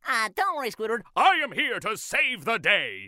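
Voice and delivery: high cartoon start swelling into heroics; SpongeBob. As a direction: go from squeaky to grand proclamation. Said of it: "he'll go from, like".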